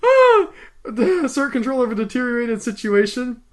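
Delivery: breaking up with laughter mid-sentence; high, helpless laugh through the narration. Real, not acted. Reader losing it over the shouted line, laughing.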